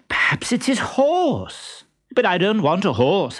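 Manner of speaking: high, put-on guessing. A narrator's reading. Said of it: "very loudly".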